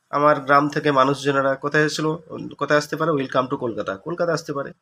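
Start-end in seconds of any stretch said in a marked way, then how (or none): none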